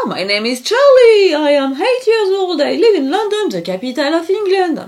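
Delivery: high, hammed-up English child accent; put-on English accent. Reading the story page aloud in a mock English accent. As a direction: read it like a proud little London boy. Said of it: "excusez-moi pour cet accent magnifique".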